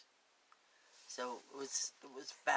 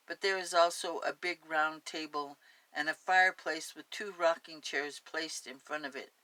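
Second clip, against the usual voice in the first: story narration; 5 dB louder than usual. Acted. Reads the story aloud.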